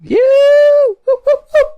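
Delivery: high, loud whooping cheer; excited cheer. Real, not acted. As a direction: whoop it up in celebration. Cheering the game going gold.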